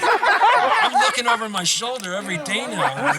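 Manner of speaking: laughing loudly through the words. Real, not acted. Old musician jokes about outliving bandmates, laughing. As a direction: chuckle it out, half joking. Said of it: "I think".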